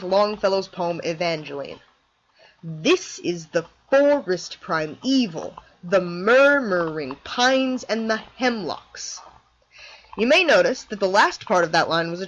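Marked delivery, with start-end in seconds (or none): 2.6-9.2 slow, DUM-da-da falling beat; dactylic recitation. Shown as a demonstration. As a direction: read it slowly, strong first beat then two light ones. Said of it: "one stressed syllable, followed by two unstressed".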